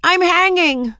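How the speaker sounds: high, loud panicked wail; terrified cry. A narrator's reading. Ramona dangling from joist, loud terrified cry. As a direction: cry out in terror. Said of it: "Ramona was terrified".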